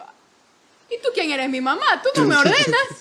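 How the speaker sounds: loud, high, laughing mock defiance; defiant retort. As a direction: snap back at the bossy app, half laughing. Mocking retort to a bossy app. (réplica desafiante, fuerte y aguda, entre risas)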